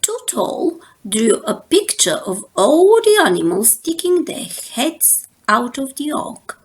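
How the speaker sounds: ordinary delivery, nothing marked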